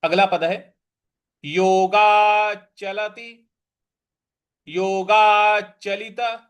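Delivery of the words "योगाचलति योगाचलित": योगाचलित drawn out slowly, doubled च; slow syllable-by-syllable. Shown as a demonstration. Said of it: "फिर आधा च है, फिर पूरा च है".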